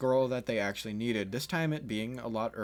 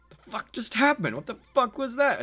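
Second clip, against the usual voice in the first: much higher, louder disbelief; stunned shout; 13 semitones higher, 9 dB louder than usual. Retold or imitated. Crowd watching Kiba surrender, loud stunned disbelief. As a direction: blurt it out in shock.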